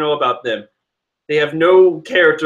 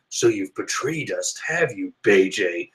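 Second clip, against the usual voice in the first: menacing villain; 7 semitones lower, 5 dB quieter than usual. Retold or imitated. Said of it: "Katakuri's just like".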